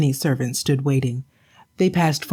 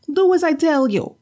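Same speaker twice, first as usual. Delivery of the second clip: high, nagging wife's voice; a shrewish wife, higher and sharp; scolding wife; 12 semitones higher than usual. Acted. Wife scolding her husband for an empty catch.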